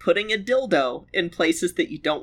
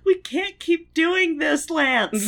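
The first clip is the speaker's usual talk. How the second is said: high, slow put-on lover's voice; Jenny, mock plea; 10 semitones higher, slower than usual. Co-host echoing Jenny pleading with Lance, high mock-dramatic voice. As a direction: whine it out as a melodramatic lover.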